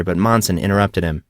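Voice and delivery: plain reading voice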